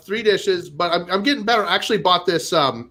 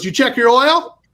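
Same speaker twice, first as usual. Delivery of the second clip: mildly raised, higher-pitched bark; mock yell, only a little louder; imitated yelling mechanic; 6 semitones higher, 3 dB louder than usual. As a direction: imitate a pushy mechanic firing a question. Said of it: "Why is he yelling at me?".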